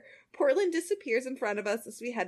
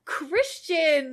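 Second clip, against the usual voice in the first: high, loud scolding call; scolding shout; 7 semitones higher, 6 dB louder than usual. Acted. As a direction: call out the name in outrage.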